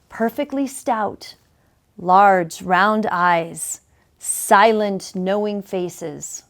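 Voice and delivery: slow, falling poetic refrain; poem recital. A narrator's reading. Reading the owl refrain to listeners, slow and hushed. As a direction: savour each word like a little owl poem.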